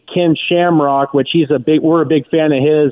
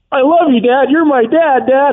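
raised high, gushing with pride; proud son gushing at his dad; 9 semitones higher than usual. Retold or imitated. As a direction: overflow with love for dad. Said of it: "I'm like".

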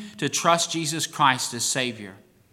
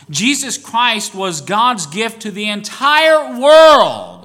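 louder, slow, building passion; fervent preaching; 4 semitones higher, 6 dB louder than usual. Real, not acted. Preacher proclaiming God's love to the church, fervent and loud. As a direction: preach it out with fire.

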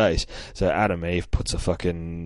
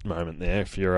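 usual speaking voice

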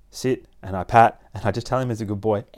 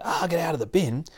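high-pitched mock scolding; mock scream; 8 semitones higher than usual. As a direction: imitate an exasperated owner telling off the dog. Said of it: "instead of screaming at it".

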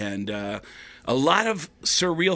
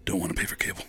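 soft, low, almost whispered admission; mild whispered confession; confiding hush; 7 semitones lower, 6 dB quieter, much faster than usual. Retold or imitated. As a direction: let him in on it quietly. Eddie confides his cheapness.

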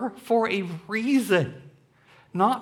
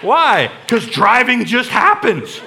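loud mock bafflement; 6 semitones higher, 8 dB louder than usual. Impersonating clueless driver.

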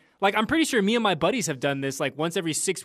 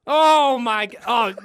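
high laughing gasp of disbelief; laughing outburst; 7 semitones higher, 7 dB louder, slower than usual. Real, not acted. [name] laughing at Fitz's SEC jab, loud laugh.

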